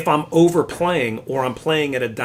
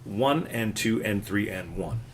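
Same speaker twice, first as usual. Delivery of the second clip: steady, quicker beat count; count closer to tempo; 4 semitones lower, 8 dB quieter, slower than usual. Shown as a demonstration. Teacher setting a new tempo for the student, brisker count. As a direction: count the beats evenly, moving along. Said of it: "a little closer to tempo di Antonio".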